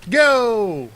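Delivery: sudden high shout. Pretend. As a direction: yell it like a starting gun. Friend shouts start of a race.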